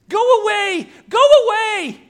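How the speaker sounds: very high, loud creature shriek; desperate scream. Acted. Preacher as Smeagol banishing Gollum, shrieking. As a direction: shriek to drive the other off.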